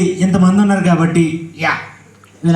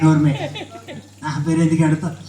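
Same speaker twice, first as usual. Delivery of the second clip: low, softer indignant retort; mild scolding; 5 semitones lower, 6 dB quieter than usual. Acted. Ventriloquist objecting to his name being used, low scolding. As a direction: shut the puppet down, low and soft.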